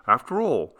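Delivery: usual speaking voice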